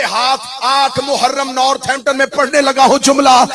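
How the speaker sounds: high, loud, impassioned climax; full-force majlis preaching. Acted. Preacher swears by Abbas before the cruellest line, high loud declamation. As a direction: hammer each phrase out to the mourners.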